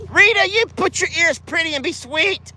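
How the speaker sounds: high, coaxing scold; sweet scolding pet talk. Real, not acted. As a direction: sweetly tell her to behave. Chiding the animal sweetly.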